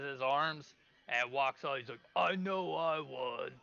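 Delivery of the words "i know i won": higher, slow, drawn-out ogre boast; triumphant creature stretching each word; boastful ogre. Acted. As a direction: crow about winning as a big ogre. Ogre boasts after finishing.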